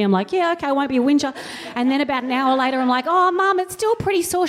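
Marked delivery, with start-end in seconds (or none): 0.3-1.3 rushed sulky reply. Retold or imitated. Child obeys scolding mum. 3.1-4.5 put-on much higher, plaintive kid voice; whiny child. Child complains to mum.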